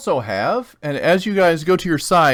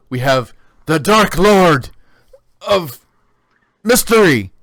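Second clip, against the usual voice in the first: rising, loud, slow title reveal; announcer build; 3 semitones higher, 7 dB louder, slower than usual. Acted. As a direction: build the name up to a peak. Announcer names Az's title.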